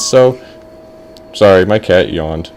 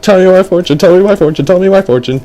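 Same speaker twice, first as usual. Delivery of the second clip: put-on high, loud, insistent child chant; eager kid; 9 semitones higher, 4 dB louder, faster than usual. Boy pesters fortune teller, high and eager. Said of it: "and he was like".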